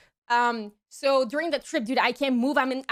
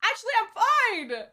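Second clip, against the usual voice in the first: drugged body voice; 8 semitones higher than usual. Retold or imitated. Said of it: "My body was just like".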